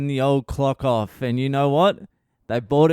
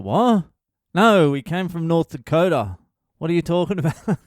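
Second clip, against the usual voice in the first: higher, louder, baffled retort; bewildered old dad; 6 semitones higher, 4 dB louder than usual. Retold or imitated. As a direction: play the confused old father brushing off his son. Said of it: "He's like".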